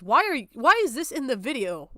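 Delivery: normal talking voice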